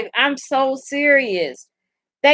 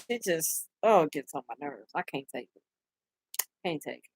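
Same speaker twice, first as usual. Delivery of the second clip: weary; 8 semitones lower, 13 dB quieter than usual. Real, not acted. Fed up, trailing off.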